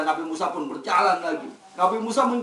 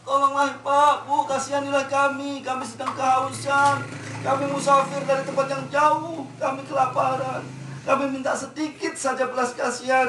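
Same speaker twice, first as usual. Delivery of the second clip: pleading traveller; 6 semitones higher than usual. Acted. Musafir begging at next door. (musafir memohon dengan suara tinggi dan pelan)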